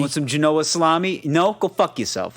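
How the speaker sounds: lightly voiced gruff Jersey uncle; Jersey accent. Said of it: "that's just how it is".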